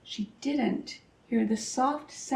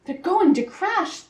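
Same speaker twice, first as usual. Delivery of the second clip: gasping alarm; 7 semitones higher than usual. A narrator's reading. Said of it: "gasped Stellaluna".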